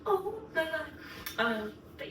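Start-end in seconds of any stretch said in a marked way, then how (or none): 0.1-0.9 high overcome oh my God; overwhelmed. Retold or imitated. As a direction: let it burst out in shock. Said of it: "I'm like".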